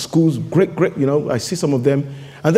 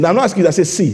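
ordinary talk, nothing marked

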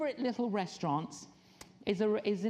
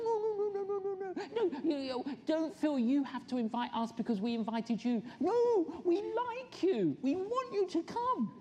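exaggerated protest; 8 semitones higher than usual. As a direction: protest in a comically high voice. Protesting a return invitation.

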